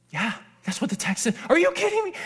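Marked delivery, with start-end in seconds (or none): 1.5-2.1 very high, incredulous squeal of a question; ecstatic disbelief. Retold or imitated. Preacher reacting to what the text said, ecstatic disbelief. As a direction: pitch leaps right up in amazement. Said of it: "I lost my mind".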